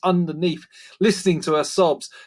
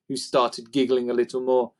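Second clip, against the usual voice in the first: ordinary delivery, nothing marked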